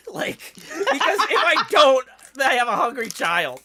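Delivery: high, loud, breaking into laughter; laughing. Real, not acted. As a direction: laugh through the words. Laughing at own situation.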